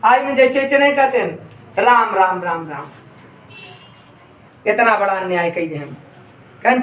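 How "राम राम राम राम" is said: sorrowful lament. Shown as a demonstration. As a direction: demonstrate a sinking, mournful repetition. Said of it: "दुख को दर्शाते हैं".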